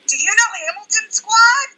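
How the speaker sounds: high, loud imitation of an excited fan; acted excited calling out. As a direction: call it over to the next car, hammed-up. Friend calling to other driver.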